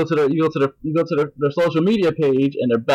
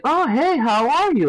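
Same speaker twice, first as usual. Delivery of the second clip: imitated gushy hello, pitched way up; mock friendly greeting; 11 semitones higher than usual. Exes suddenly keen on him, mock high gushing.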